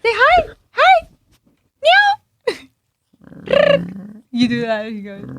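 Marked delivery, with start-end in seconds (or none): 0.0-1.1 high pet voice. Real, not acted. Owner urging her dog to say hi, squeaky coaxing. 1.8-3.8 very high playful cat meow; squeaky meow. Real, not acted. Owner meowing at her dog, high and playful.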